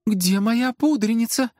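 high, slow, worried questions; growing agitation. A narrator's reading. Assistant misses her compact, increasingly agitated. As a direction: pitch climbing with worry. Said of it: "Ещё больше заволновалась Элизия".

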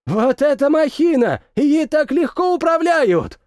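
shouting high over the noise. A narrator's reading. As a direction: yell it over engine din. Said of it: "прокричал офицер-инспектор".

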